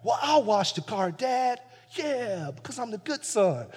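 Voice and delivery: mock-pious good son showing off; smug good son. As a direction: ham up the teacher's pet. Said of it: "and dad's like".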